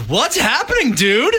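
very high, breezy mock greeting; goofy high greeting. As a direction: chirp it like a surfer dude. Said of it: "I was like".

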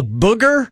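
"booger" with a short oo for "burger"; own mispronunciation. Shown as a demonstration. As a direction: say "booger", dropping the first r of burger. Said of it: "the other one I, I say is".

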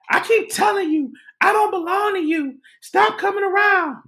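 high-pitched imitation of a woman yelling; retold, exasperated high yell; acted-out yelling. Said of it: "and she's yelling".

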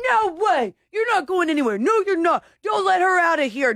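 hammed-up yell of pushy party friends; mock party shout. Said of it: "they're like".

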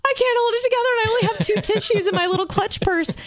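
high, rushed, overwhelmed cry of panic; about to lose it, tissues running out; tearful panic. Retold or imitated. Sobbing at the wedding, panicking over tissues. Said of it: "I'm like".

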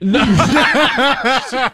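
loud high cackle of a laugh; high burst of laughter. Real, not acted. Storyteller cracking up at his own punchline, laughing high.

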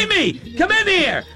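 high shout across the room; calling out. Real, not acted. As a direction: call her in from another room. Host calls someone into studio.

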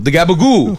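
gabagoo said "gabba-goo", no final l; Italian-American gabagoo. Retold or imitated. Customer ordering at the deli, "gabagoo" Jersey style. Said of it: "I'm like".

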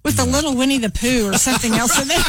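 ordinary talk

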